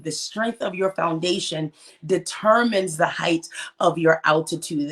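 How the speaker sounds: ordinary delivery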